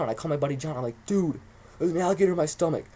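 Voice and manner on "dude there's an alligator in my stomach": high, loud mock panic; urgent, worked-up imitation of alarm; freaking out. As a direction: retell a panicked call to a buddy. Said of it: "I'm freaking out".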